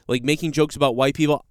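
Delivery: usual speaking voice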